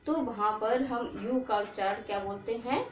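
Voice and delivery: ordinary talk, nothing marked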